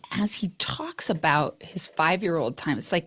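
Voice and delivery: quiet whispered aside; whispering. Real, not acted. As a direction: whisper it behind his back. Aside about Simon.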